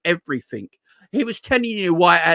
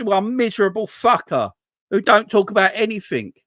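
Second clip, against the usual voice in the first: ordinary delivery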